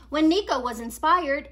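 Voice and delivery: ordinary delivery, nothing marked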